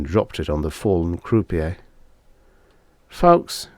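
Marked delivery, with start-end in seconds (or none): 3.1-3.7 apologetic. A narrator's reading. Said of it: "in an apologetic tone".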